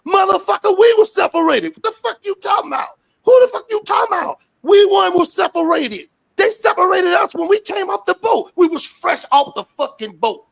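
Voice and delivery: shouting high and loud in outrage; furious shout. Real, not acted. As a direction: hurl it at them, full of fury. Furious rant about families separated.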